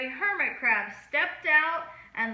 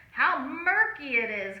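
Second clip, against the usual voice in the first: ordinary delivery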